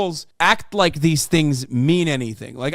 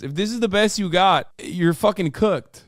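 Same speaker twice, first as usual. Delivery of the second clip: usual speaking voice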